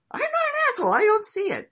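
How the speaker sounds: very high, loud mock protest; squeaky denial. Accused friend protesting, squeaky high and loud. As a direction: squeal it like a cornered kid.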